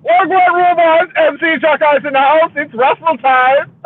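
imitating an over-the-top show MC, slowing on the last line; MC hype voice. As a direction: hype the crowd like a wrestling MC. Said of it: "stood there in the middle of the show".

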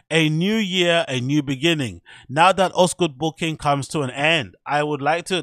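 hammed-up German accent, stiff and formal. Vlogger reading a DJ's caption in a German accent. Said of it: "but my German accent".

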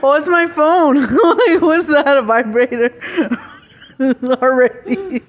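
laughing loudly, high; cracking up over the phone. Real, not acted. Phone buzz, laughing hard.

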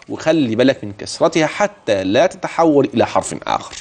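ordinary talk, nothing marked

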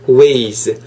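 ordinary delivery

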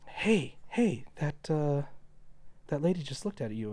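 quietly tip off a friend; discreet nudge. Acted. Elaine pointing out the lady to George, discreet nudge.

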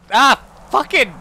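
high, loud yelp of alarm; sudden slow, shouted curse; startled swearing. Real, not acted. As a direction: break off and cry out in shock. Startled mid-sentence by the game.

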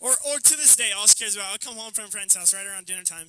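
normal talking voice

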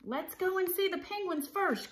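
high, eager storybook voice; keen little cat piping up; excited cry. A narrator's reading. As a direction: plead eagerly to go, pitched well up. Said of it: "cried Splat".

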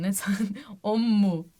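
ordinary talk

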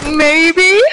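silly drawn-out mimicry, rising at the end; funny voice. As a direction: mimic a goofy voice, stretching the word upward. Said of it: "sounded like you did a funny voice".

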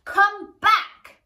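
high, loud shout; shouting queen. Acted. Queen shouts for the princess to come back, loud high shout. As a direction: shout it after the runaway. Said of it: "shouted the queen's deafness".

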